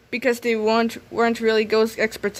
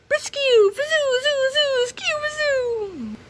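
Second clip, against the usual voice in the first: very high zapping laser sounds; laser battle noises; 14 semitones higher, slower than usual. Acted. Heroes and monster trade laser blasts, high zapping noises. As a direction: fire lasers with your voice, high and wild.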